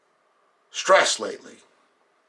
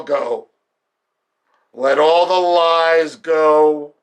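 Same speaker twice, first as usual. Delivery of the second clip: drawn-out words building to a higher shout; building insistence; 3 semitones higher, slower than usual. Acted. Meditation leader urging release of betrayal and lies, increasingly forceful. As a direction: stretch each "go" with mounting force.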